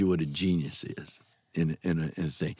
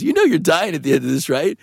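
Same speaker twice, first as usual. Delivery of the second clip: offhand, higher and louder retelling; very casual; 5 semitones higher, 5 dB louder than usual. Retold or imitated. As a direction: toss it off casually in a raised voice. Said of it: "Just like that. He goes".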